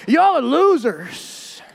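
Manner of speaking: very slow, drawn-out jeer; mocking put-down. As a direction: stretch the insult out, hammed up. Blunt friend insults the group.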